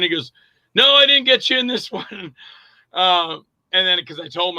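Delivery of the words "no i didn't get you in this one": higher voice breaking into a chuckle; laughing Nick. Retold or imitated. Director admitting to actor friend, higher and laughing. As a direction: imitate an amused admission, laughing near the end.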